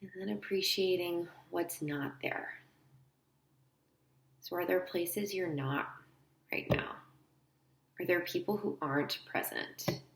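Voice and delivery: soft, unhurried guide with long pauses; quiet guided-visualization voice; guided meditation. Acted. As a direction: lead them gently inward, leaving space between phrases.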